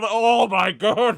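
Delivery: slow, loud, high gasp of horror; appalled. Real, not acted. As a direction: drag out the shock. Reacting to racist advert.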